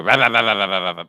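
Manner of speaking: loud imitated truck engine brake; engine brake noise. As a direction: rumble it out like a truck braking. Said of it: "when you hear the trucks go".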